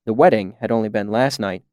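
ordinary delivery

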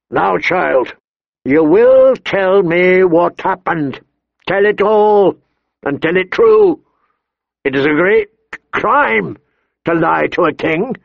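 high, emphatic, irritated command; thick with irritation. A narrator's reading. King questioning a child, stern and irritated. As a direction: order it weightily, drawing out key words. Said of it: "his voice thick with irritation".

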